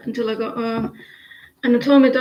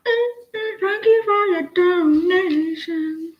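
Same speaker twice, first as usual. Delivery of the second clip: mock thank-you voice; 7 semitones higher, slower than usual.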